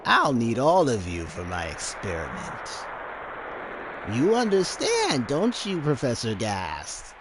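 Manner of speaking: creepy mad scientist. Acted. Hojo gloating to Gast about the sample, slow, high and creepy. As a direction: savour every word like a greedy villain.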